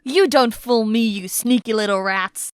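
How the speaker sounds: slow, loud villain sneer; menacing gang boss voice; slow theatrical villain menace. Acted. Gang leader threatens captives.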